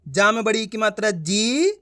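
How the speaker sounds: ordinary talk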